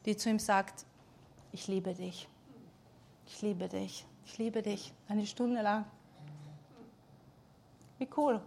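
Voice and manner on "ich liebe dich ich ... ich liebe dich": very soft, hushed and tender, each "ich liebe dich" barely above a breath; gentle voice of God. Retold or imitated. Preacher retells the voice Ben heard in the dark, hushed and tender. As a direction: murmur it softly like a voice in the dark. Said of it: "hört er eine Stimme".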